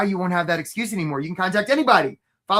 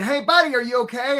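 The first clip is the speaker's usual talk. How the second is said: higher, louder hail to someone in the water; mock calling out; 6 semitones higher, 3 dB louder than usual. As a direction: call out as if checking on a stranger.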